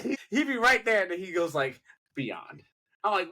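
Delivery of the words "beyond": imitated hushed, low drop; soft, low one-word impression; Myron. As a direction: drop low and soft, mimicking his delivery. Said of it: "You had to say it like that".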